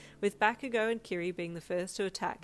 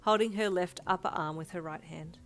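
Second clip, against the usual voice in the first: plain reading voice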